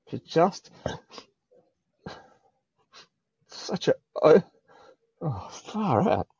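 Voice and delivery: overcome, high and halting; getting emotional. Real, not acted. Guest reliving an overwhelming welcome, getting emotional. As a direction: struggle for words through welling emotion, long pauses. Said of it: "I'm gonna get emotional again".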